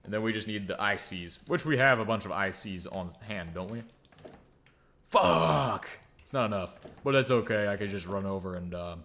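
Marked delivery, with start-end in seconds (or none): 5.0-5.9 loud, high drawn-out curse; long exasperated shout; frustrated shout. Real, not acted. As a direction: yell it out in frustration. Finds he is short of parts.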